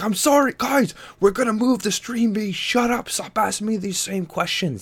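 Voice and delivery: high, loud, whiny imitation of an irate streamer; mock freakout. Host imitating Andy freaking out at his audience, high whiny mock rant. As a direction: squeal it like a host losing it at chat. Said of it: "he's freaking out on his audience".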